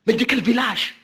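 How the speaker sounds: high-pitched, eager guessing of his home region; eager excited questions. Pretend. To the stranger in New York, guessing his village, high and eager. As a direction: pounce on him with delighted questions, voice up high. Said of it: "mais vous allez courir pour dire".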